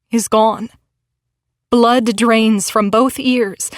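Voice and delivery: ordinary delivery, nothing marked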